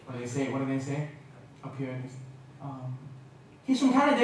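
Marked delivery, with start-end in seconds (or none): 3.6-4.2 high, louder playful imitation of an English accent; mock English accent. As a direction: put on a cheeky northern English accent. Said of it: "trying to copy the accent of here".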